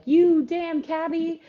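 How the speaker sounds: put-on loud, high, slow Philly accent yell; hammed-up Philly accent shouting at a cabbie; mock Philly yell. Writing teacher acting out a Philly guy yelling at a cabbie, mock Philly accent shout. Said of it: "he'd said that in a thick Philly accent".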